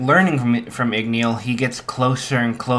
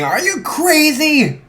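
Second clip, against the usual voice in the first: much higher panicked yell; screaming; 4 dB louder than usual. Retold or imitated. Izuku jumping away from the flame, high panicked scream. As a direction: dodge and shriek at him.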